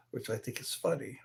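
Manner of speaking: hushed whispering aside. Real, not acted. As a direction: drop to a whisper. Drops to whisper.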